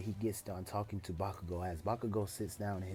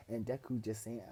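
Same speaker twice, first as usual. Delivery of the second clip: ordinary delivery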